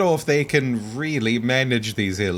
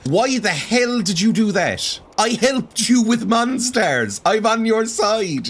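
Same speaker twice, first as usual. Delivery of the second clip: higher, slow, outraged scolding at betrayers; indignant outburst; 11 semitones higher, 3 dB louder, slower than usual. Real, not acted. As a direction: tell off the ungrateful NPCs. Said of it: "I'm so mad".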